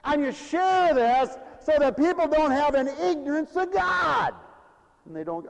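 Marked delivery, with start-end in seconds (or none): 0.0-4.3 higher, louder preacher's cry; preaching shout. Acted. Preacher warning congregation, climbing into a shout. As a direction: drive it home to the church.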